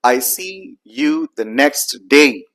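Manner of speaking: slow, every word separate with the T in "next" sounded; emphasized full sounds. Shown as a demonstration. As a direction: spell out "next day" slowly, T and all. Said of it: "you'd be emphasizing by saying".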